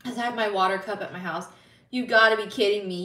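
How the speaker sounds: usual speaking voice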